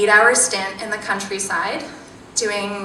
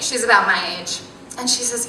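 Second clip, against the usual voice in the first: ordinary talk, nothing marked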